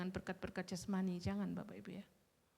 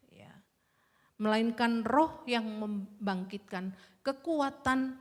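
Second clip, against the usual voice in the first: slow, louder solemn proclamation of the verse; scripture reading; 3 semitones higher, 7 dB louder, much slower than usual. Acted. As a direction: read the verse slowly, weighing every word. Preacher reads scripture aloud.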